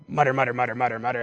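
low, soft crowd grumble; mock muttering. Everybody grumbling about Jesus's company, muttering. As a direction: imitate a disapproving crowd muttering under its breath. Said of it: "everybody starts to mutter".

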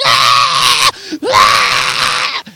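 put-on loud wailing hungry-baby screams; mock infant wail at full volume; imitated baby scream. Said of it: "he's yelling and screaming at me".

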